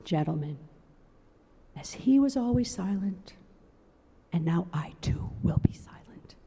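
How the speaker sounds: hushed, near whisper at the end; very soft voice. A narrator's reading. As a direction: let it sink into a whisper. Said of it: "in a very soft voice".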